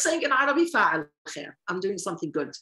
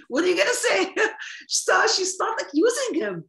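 laughing; 8 semitones higher, 3 dB louder than usual. Real, not acted. Laughs while telling story.

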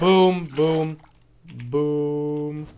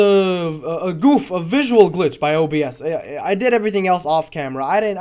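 ordinary delivery

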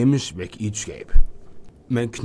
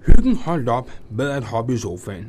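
ordinary delivery, nothing marked

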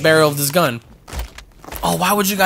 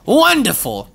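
"Wonderful" pitched high, drawn out and falling; sing-song mock delight on "Wonderful"; high sarcastic wonderful; 7 semitones higher, slower than usual. Real, not acted.

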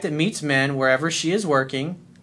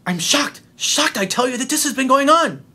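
mock outrage rising higher and louder; movie quote shock; 9 semitones higher, 4 dB louder than usual. Coach quoting the Casablanca officer in hammed-up shock. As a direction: ham up the famous Casablanca line. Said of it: "and he's like".

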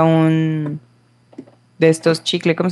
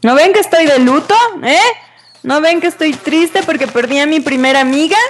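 mock indignant; 7 semitones higher, faster than usual. Scolds snakes while mourning.

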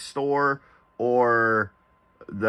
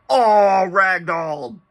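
surprised; 5 semitones higher, 4 dB louder, slower than usual. Real, not acted. Sudden reaction in game.

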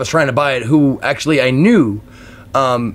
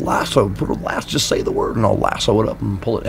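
badly faked halting screen-idol voice; Jimmy Stewart; 5 semitones lower, 4 dB quieter than usual. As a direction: stammer softly through an old movie-star impression. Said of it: "I can't do Jimmy Stewart".

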